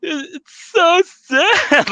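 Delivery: high, slow, hammed-up blubbering; mock sobbing. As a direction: wail it like a crybaby, playing it up. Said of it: "And I'm like".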